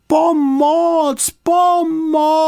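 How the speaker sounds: very high, loud repeated shouts; full-voiced high distress calls; loud cries for help. A narrator's reading. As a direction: shout desperately for rescue, high and long. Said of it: "potom pořádně nahlas".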